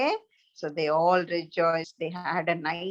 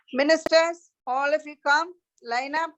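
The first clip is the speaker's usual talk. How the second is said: called out high and loud; a king calling his court, raised and commanding; royal summons; 6 semitones higher, 3 dB louder than usual. Acted. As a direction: summon the ministers across the hall.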